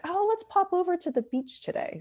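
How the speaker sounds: high, airy mock-casual voice; breezy carefree tourist. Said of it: "it's not simply like".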